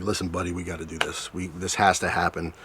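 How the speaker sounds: low, hushed urging to himself; hushed pep talk. Retold or imitated. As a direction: coax yourself under your breath. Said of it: "a pep talk, you know, with the guy downstairs".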